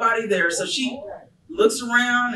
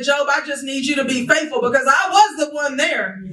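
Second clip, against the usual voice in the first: preaching at full force; 11 semitones higher than usual. Acted. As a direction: lift the church with a soaring promise.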